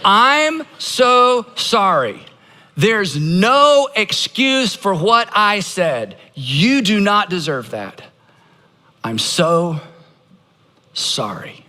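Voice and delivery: slow, emphatic, falling away at the end; model apology. Shown as a demonstration. Pastor showing how to repeat an apology, slow and deliberate. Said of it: "rinse and repeat".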